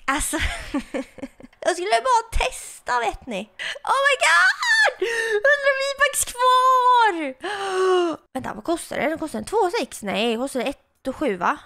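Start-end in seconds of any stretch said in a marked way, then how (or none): 3.9-7.1 real shocked shriek. Real, not acted. Only 100 V-bucks left. 7.2-8.3 shocked gasp. Real, not acted. Reacts to remaining V-bucks.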